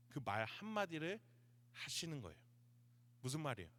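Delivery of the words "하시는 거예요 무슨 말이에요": ordinary delivery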